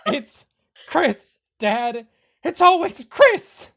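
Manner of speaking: very loud, high wail of blame; hammed-up anguished outburst; exasperated yelling. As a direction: build to a full exasperated shout. Son blames Chris for everything.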